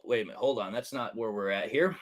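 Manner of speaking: quiet muttered self-correction. Real, not acted. Host catching his own misread comment, muttered quietly. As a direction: drop it under your breath, catching the mistake.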